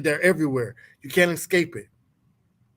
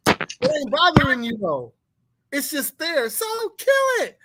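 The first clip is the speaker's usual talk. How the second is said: mock wife; 8 semitones higher than usual. Wife's reply, voiced high.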